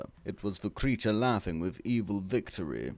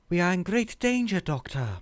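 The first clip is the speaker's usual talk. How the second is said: higher, louder put-on Harriet voice; grave warning; 11 semitones higher, 3 dB louder than usual. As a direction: warn them with mock gravity. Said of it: "gravely said".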